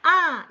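아 loud and high, dropping; loud falling 아; sharp falling tone. Shown as a demonstration. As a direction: punch 아 as a fourth-tone fall. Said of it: "이런 소리를 내주셔서".

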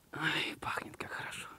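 soft whispered delight; whispering. Real, not acted. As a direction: breathe it out in a whisper. Sniffing the ram.

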